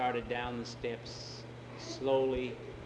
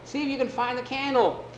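high, raised voice; louder, higher-pitched order; urgent calling out; 12 semitones higher, 6 dB louder than usual. Acted. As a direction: call instructions across the dark. Friend calls across the dark.

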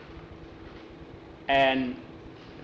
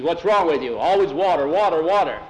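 louder, scornful telling-off; scolding boss; 3 semitones higher, 5 dB louder than usual. Acted. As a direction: Pedro nagging the boys, loud and sharp. Boss Pedro scolds the thirsty boys.

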